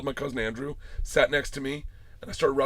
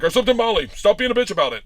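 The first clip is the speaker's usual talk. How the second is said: loud, higher-pitched retold confession; imitating a friend brushing it off; brash retort; 6 semitones higher, 8 dB louder than usual. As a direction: impatiently shove the confession out, loudly.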